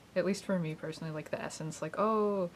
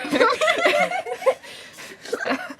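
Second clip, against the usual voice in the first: high laugh; 6 dB louder than usual. Real, not acted. Laughs about scary daycare.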